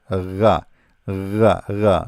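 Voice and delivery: ra with the throaty French r, Arabic ghain; pronunciation demo. Shown as a demonstration. Teacher shows a letter French speakers can say, pronunciation demo. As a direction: sound out ra separately, r made in the throat. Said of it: "have no problem to say it".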